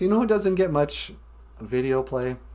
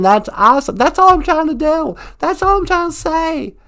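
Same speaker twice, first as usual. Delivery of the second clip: put-on southern accent; 3 semitones higher, 6 dB louder than usual. Puppet Emily loudly explaining her goals in a southern accent. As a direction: gush in the thick southern accent. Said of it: "really thick southern accent".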